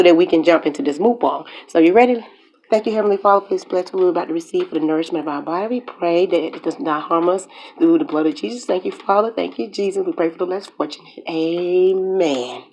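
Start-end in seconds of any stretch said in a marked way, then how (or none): 2.7-12.6 soft, hushed mealtime grace; quiet prayer. Acted. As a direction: murmur a quick grace before eating. Grace before the meal.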